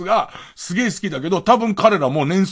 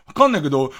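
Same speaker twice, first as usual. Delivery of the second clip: ordinary talk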